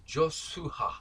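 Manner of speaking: "Joshua" said slowly as Jos-su-ha; "Joshua" with s and a breathed final ha; careful pronunciation of Joshua. Shown as a demonstration. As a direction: spell out "Joshua" by ear, joss-SOO-ha. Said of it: "I believe it is Joshua".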